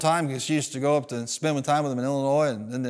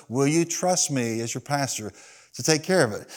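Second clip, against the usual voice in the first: soft, lower retold pastor; calm, unruffled pastoral voice; 4 semitones lower, 5 dB quieter than usual. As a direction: repeat the question patiently and softly. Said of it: "he just looked at him very calmly".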